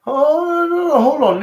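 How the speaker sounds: high, loud startled cry; sudden outburst. Real, not acted. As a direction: jump in to stop them.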